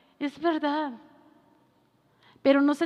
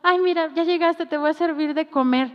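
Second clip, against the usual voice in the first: sweet wife; 4 semitones higher, 7 dB louder than usual. Retold or imitated. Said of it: "llega y está la esposa".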